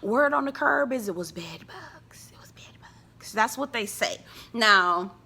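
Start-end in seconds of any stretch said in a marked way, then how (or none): 0.0-3.3 unvoiced conspiratorial whisper; whispering the bed bugs rumor; gossip whisper. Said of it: "quiet as it's kept".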